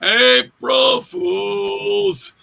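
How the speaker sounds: very slow, stretched April Fools in an odd voice; drawn-out reveal. Retold or imitated. Herschel revealing the prank to the room, slow drawn-out. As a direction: savour the punchline slowly.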